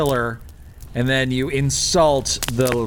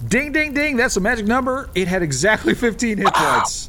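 high gleeful ding ding; excited; 8 semitones higher than usual. Real, not acted. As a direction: announce it like a game show bell. Celebrating exact kill.

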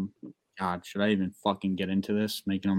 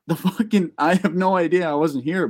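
higher and louder, pleading ignorance; exasperated denial; 7 semitones higher, 6 dB louder than usual. Retold or imitated. Telling the landlords he knows nothing, exasperated denial. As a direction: throw your hands up, you weren't even there.

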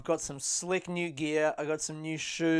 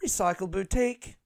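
Recycle Boutique rising on BOUTIQUE; train announcer; 3 semitones higher than usual. Acted. Comedian mimicking the train stop announcement, rising announcer. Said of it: "it's like on the train".

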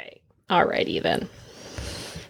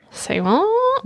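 surprised. Retold or imitated. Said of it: "And I'm like".

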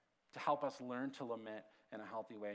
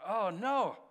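retold dismay; 6 semitones higher, 7 dB louder than usual. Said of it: "I thought".

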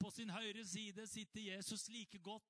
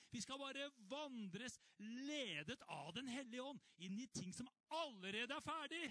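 preaching at full force; 8 semitones higher than usual. Acted. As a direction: press the truth home to the church.

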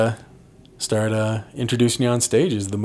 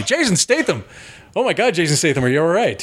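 higher-pitched, startled concern; mock alarm; 6 semitones higher than usual. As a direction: act shocked and worried for a celebrity. Imagines The Office star reacting.

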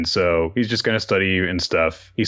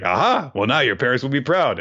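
chirpy Anya; 6 semitones higher, faster than usual. Retold or imitated. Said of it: "Anya's like".

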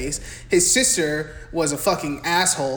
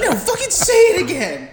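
much higher, louder, breaking into a laugh; shrill mock mom dare, laughing; 14 semitones higher, 6 dB louder than usual. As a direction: dare him as the shrieking mom. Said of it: "It's like".

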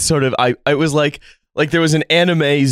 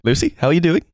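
mock Kiwi accent; 4 semitones higher, faster than usual. Playing along in Kiwi skit.

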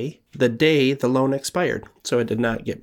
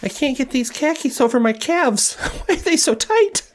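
much higher whiny voice; high squeaky voice. Acted. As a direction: whine it up high. In-character complaint, high squeak.

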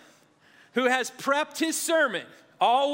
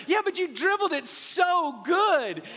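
mom voice; 7 semitones higher than usual. Retold or imitated. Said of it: "And she goes".

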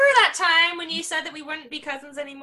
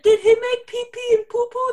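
higher, louder mock of a suited man's baby talk; 7 semitones higher, 5 dB louder than usual. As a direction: parody a grown man cooing about pee-pee and poo-poo. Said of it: "a man in a suit that's like".

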